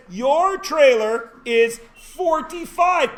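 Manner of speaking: high, loud, slow proclamation; noble's decree. Acted. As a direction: draw it out like a pompous noble. Voicing a noble announcing a tax.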